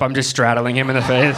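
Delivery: ordinary talk, nothing marked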